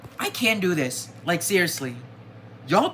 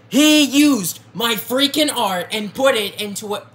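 louder, higher outburst over stolen art; angry; 5 semitones higher, 5 dB louder than usual. Real, not acted. Artist ranting at viewers over stolen art, angry raised voice. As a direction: let the real anger push the voice up. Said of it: "I am very angry right now".

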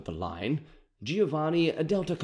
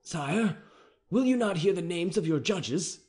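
higher, tense officer voice; captain reading the charge, uneasy; anxious captain; 6 semitones higher than usual. A narrator's reading. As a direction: deliver the hard law nervously. Captain speaking to the king.

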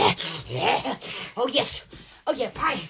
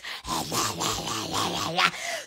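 la-la cackle; 12 dB quieter than usual. Real, not acted. Wild laughing outburst.

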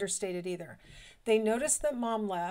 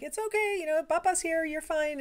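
high, soothing grandpa voice; reassuring grandpa; 7 semitones higher than usual. Retold or imitated. Grandpa soothes the grandson, high and reassuring. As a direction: comfort the toddler. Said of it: "my husband would be like".

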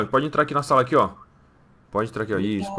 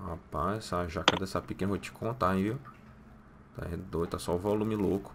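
softer, lower, gaping aside; awed aside; 7 semitones lower, 12 dB quieter than usual. Acted. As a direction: murmur it so she can't hear. Soldier's awed aside again. (soldado comenta baixinho, espantado, atrás dela)

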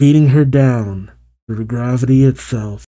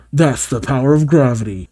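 ordinary delivery

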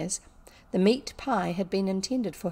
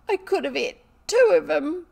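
high, loud tearful wail; acted bawling protest; crying; 9 semitones higher, 7 dB louder than usual. A narrator's reading. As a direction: cry it out as a hurt servant girl. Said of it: "cry into her pillow".

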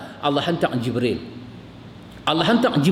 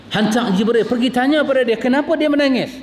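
higher and louder, swelling preacher's cry; impassioned preaching; 8 semitones higher, 3 dB louder than usual. Acted. Preacher marvelling to the congregation at God sending Jibril, impassioned. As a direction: lift the voice in awe at God's love.

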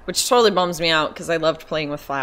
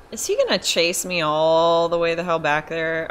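ordinary talk

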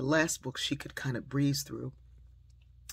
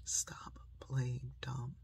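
soft, slow, word by word; hushed, deliberate reproof; mother's quiet admonition; 7 dB quieter, much slower than usual. Retold or imitated. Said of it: "I heard my mother saying that".

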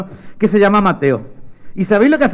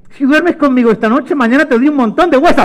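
high, loud coaxing promise; eager bribing; 9 semitones higher, 5 dB louder than usual. Acted. Mateo bribing his dog with bones, eager and high. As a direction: sell the treat excitedly.

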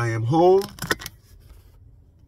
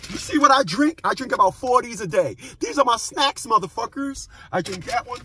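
mock squeaky brag; high taunting voice; 9 semitones higher than usual. Vlogger brags about his snacks to viewers, high and teasing.

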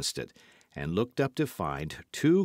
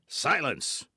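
command; 6 semitones higher than usual. A narrator's reading. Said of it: "the highwayman commanded".

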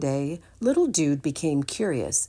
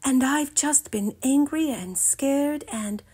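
lightly voiced Buttercup; 9 semitones higher than usual. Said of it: "said Buttercup".

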